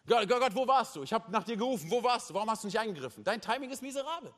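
high, urgent accusing questions; acted reproach to God. Acting people's reproach to God.

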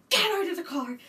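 high, loud angry order from mother; mother ordering her sick daughter out of the car; furious mum. Retold or imitated. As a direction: bark it like a livid mother. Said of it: "I have never seen her so angry".

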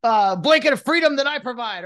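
loud, high movie-speech delivery; hammed-up indignant military officer; Colonel Jessup. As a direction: thunder it out as a righteous speech. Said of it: "Colonel Jessup in, uh, A Few Good Men".

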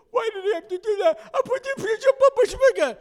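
very high, loud, tearful wail; crying. Retold or imitated. Boy crying to his dad on the bed, tearful wail. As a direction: sob it out like a hurt little boy. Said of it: "I'm crying".

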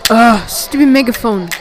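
ordinary talk, nothing marked

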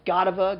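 Godiva said GAH-duh-vuh; Godiva with a short, weak middle syllable; playful mispronunciation. Shown as a demonstration. Playing with brand name's pronunciations.

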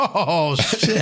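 high, laughing exclamation; laughing shock. Real, not acted. Shocked by dark joke.

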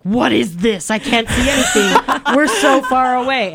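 half-laughing mock outrage; laughing complaint. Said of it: "I was like".

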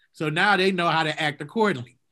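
usual speaking voice

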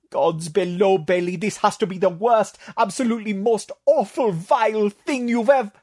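high creature voice, rising in outrage; Tatok ranting. Acted. Tatok berating Bailey, high and rising to outrage. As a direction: build the outrage until you're cut off.

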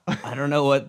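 usual speaking voice

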